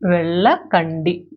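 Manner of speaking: వెళ్ళకండి slowly, broken into parts; measured, drawn-out pronunciation demo; slow teaching demo. Shown as a demonstration. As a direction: model the word slowly for learners. Teacher repeats it slowly for learners.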